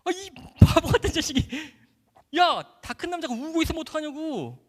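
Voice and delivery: loud, high-pitched exasperated outburst, reenacted; flustered scolding. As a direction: scold a crying friend in a panic. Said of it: "너무 당황해서 말았더니".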